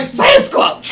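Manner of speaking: high, breathy yelp of the name; mock startled shout. Friends joking about a drunk, then shouting in mock fright. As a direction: blurt it out like you just saw a monster. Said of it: "And he's like".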